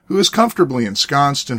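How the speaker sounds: upbeat announcer voice over music; bright podcast opening read; radio host intro. Acted.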